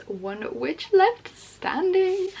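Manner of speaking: high, slow trailer-voice flourish; milk the last line like a movie trailer; dramatic blurb read. Pretend. Reading the blurb to viewers, slow and dramatic.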